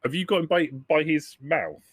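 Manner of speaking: ordinary delivery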